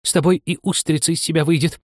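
high-pitched, irritated retort; snapping back. A narrator's reading. Young crab answering her mother, snappishly. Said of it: "огрызнулась та".

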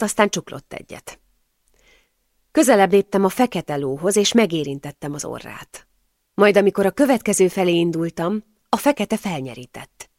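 plain reading voice

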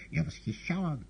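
very high mimicked bird cry; screeching parrots. A narrator's reading. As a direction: squawk it twice like an admiring parrot. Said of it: "оглушительно кричали".

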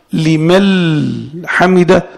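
"لمن" as "limal", n swapped for a held l; invalid pronunciation. Shown as a demonstration. Teacher repeating what invalidates prayer, "لمن" mispronounced as limal. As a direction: hold a wrong l ending "لمن", pause, then "حمده". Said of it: "فسد الصلاة".

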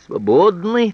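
loud, high, startled echo; настороженный переспрос. A narrator's reading. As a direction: query the word back in surprise. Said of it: "переспросил осмотрительный Роже". (удивлённо переспрашивая, громко и высоко)

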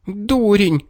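high, exasperated cry; fox yelling. A narrator's reading. Fox scolds the clueless bear, high mock yell. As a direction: wail it high without extra volume. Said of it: "завопила лиса".

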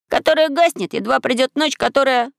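put-on high-pitched boyish questioning; high, insistent young voice; ringing question. Boy continues his ringing question.

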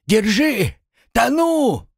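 very high-pitched, drawn cries for help; panicked yelling. A narrator's reading. Drowning man flails and begs to be held, panicked yelling. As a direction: cry out as if going under. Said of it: "он с воплем стал колошматить".

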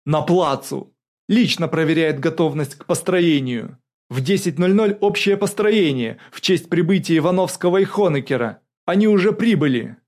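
loud, high, agitated report. A narrator's reading. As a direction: shout the report back, caught up in alarm. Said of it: "передалось настроение Богданова и Журбина". (взволнованно, почти криком)

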